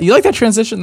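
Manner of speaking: high, smug teasing question. Acted. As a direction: show off the slick segue. Boasting about his segue.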